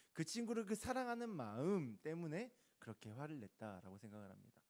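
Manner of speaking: normal talking voice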